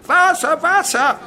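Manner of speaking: very high, very loud cries; desperate scream. Acted. Burned pupil screaming for water after the explosion.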